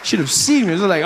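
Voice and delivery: usual speaking voice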